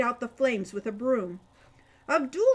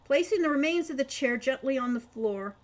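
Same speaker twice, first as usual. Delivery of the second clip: ordinary delivery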